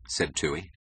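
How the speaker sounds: usual speaking voice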